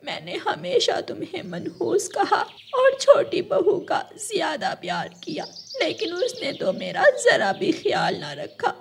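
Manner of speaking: high, tearful, breaking voice; mother-in-law weeps as she begs forgiveness; crying mother-in-law. Acted. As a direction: sob out the apology. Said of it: "रानो की सास रोने लग जाती है".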